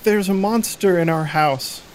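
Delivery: put-on a girl telling strangers about a monster; scared little girl.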